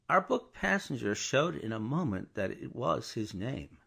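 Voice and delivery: ordinary delivery, nothing marked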